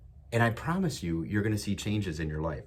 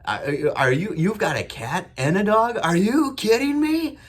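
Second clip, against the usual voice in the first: much higher, louder, slow goofy amazement; goofy loud excitement; 11 semitones higher, 7 dB louder than usual. Retold or imitated. Dad hyping up his moody daughter in the car, loud goofy disbelief. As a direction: play it up like a silly excited dad. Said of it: "I was saying it real loud, real goofy".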